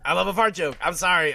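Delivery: loud, high-pitched insistence; defiant confession. Real, not acted. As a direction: own up to it loudly, unapologetically.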